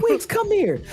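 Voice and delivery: very high-pitched, urgent call; Andre Iguodala, exasperated. Retold or imitated. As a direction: imitate a veteran summoning his teammate. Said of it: "And he said".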